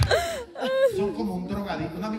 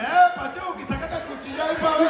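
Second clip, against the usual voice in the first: high, louder tipsy catcall; 10 semitones higher, 6 dB louder than usual. Retold or imitated. Drunk aunt catcalling Chucky at the party, loud and high. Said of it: "Dos tías mías que estaban borrachas".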